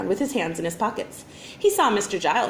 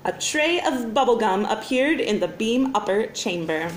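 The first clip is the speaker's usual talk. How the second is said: ordinary delivery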